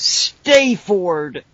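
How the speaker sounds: STAYFORD in a drawn-out, overblown American accent; hammed-up American twang on Stayford, long AY; badly faked American, stretching out the word Stayford; bad fake American accent. Shown as a demonstration. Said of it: "incredibly bad fake American accent".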